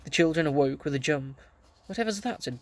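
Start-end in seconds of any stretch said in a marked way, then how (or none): 1.9-2.4 a startled question, pitched higher; jolted awake and alarmed; a high, startled whatever's that. A narrator's reading. Woken by a screech at night.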